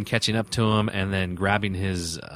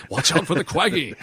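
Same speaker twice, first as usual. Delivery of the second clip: mock fantasy-movie warning through a laugh, higher; 7 semitones higher, 3 dB louder than usual. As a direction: play a Lord of the Rings character warning of the Quaggy. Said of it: "something out of, like, Lord of the Rings".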